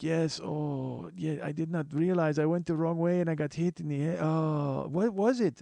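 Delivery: put-on dazed cabbie in a botched Ethiopian accent; bad Ethiopian accent. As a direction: groan through a poor Ethiopian accent. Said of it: "I can't do an Ethiopian accent".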